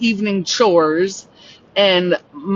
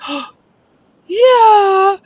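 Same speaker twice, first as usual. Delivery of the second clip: sharp gasp, then a high, loud yeah; gasping relief; 14 semitones higher, 6 dB louder than usual. Retold or imitated. Shaken teen boys offered a hug by a neighbor, gasping yes.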